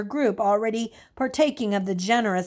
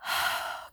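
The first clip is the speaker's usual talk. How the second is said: deep sigh; 8 dB quieter than usual. A narrator's reading. Stephen relieved after Mister Reputable leaves, deep sigh. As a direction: let out a long, deep sigh. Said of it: "He exhaled deeply".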